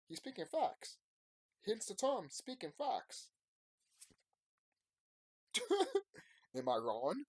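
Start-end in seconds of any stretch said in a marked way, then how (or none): 5.5-6.2 high, loud delighted laugh; burst of laughter. Real, not acted. Laughs after punchline.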